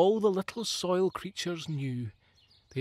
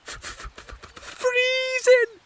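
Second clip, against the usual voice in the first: high squeak stammering freezing; stuttering with cold; 6 dB louder, slower than usual. Acted. Second woodlouse shivering, stuttering high. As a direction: chatter out the f's, tiny and frozen. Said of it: "said the other".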